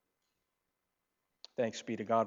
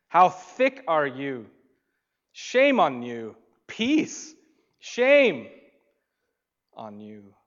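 mock scolding; 2 semitones higher, 9 dB louder, slower than usual. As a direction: berate the disciples in a loud, slow voice. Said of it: "He could have dismissed them".

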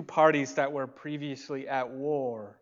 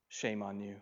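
softer, low "Shame on you"; quiet repeat; 3 semitones lower, 7 dB quieter than usual. Shown as a demonstration. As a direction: drop it to a murmur.

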